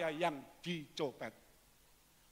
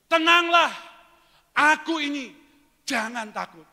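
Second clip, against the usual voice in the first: much higher and louder call; proclaiming; 9 semitones higher, 12 dB louder than usual. Acted. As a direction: call out over the storm. Jesus's words proclaimed. (seruan lantang)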